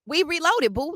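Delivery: high sing-song taunt, pitched well up; a high teasing chant of we reloaded boo; mocking taunt. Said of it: "I said".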